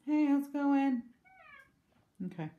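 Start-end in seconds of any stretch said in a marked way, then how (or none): 0.1-1.0 high cooing pet talk; talking to cat. Real, not acted. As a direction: coo at the cat.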